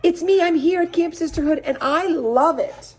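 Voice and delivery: high, bubbly delight; happier and happier. Acted. Lulu telling her parents on the phone, gushing. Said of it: "sounding happier by the minute".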